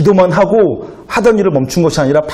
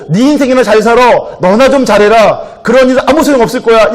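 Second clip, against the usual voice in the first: imitated scoffer, raised pitch and louder; mocking jeer; 7 semitones higher, 4 dB louder than usual. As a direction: jeer loudly at someone's efforts, acted out. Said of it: "누가 그것을 보고서는 비웃고". (비웃듯 높고 큰 목소리로 흉내 내기)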